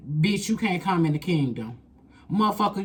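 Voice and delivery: ordinary talk, nothing marked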